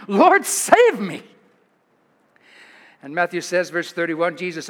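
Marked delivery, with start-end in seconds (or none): 0.1-1.2 higher, louder and slow desperate plea; sinking Peter cries for rescue; crying out. Acted. Peter sinking calls to Jesus, desperate cry. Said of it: "he cried out".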